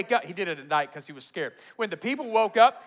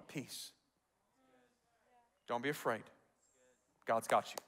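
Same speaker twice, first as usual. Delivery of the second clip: low, hushed and comforting; soothing reassurance; 6 semitones lower, 10 dB quieter than usual. Real, not acted. Preacher to people in whatever situation, soft reassurance. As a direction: settle them softly, leaving space between phrases. Consoles the congregation after the verse.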